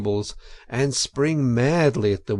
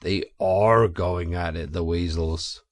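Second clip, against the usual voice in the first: placid badger; 6 semitones lower than usual. A narrator's reading. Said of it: "the Badger only remarked placidly".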